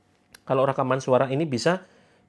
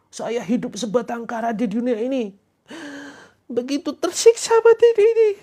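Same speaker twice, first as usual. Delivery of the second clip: high, wailing self-pitying voice, rising higher and louder at the end; melodramatic lonely lament; 10 semitones higher, 4 dB louder than usual. Retold or imitated. Coach mocking a lonely follower's lament, hammed-up wail. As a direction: ham up a lonely soul bemoaning fate. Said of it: "Jangan kemudian ucapkan begitu".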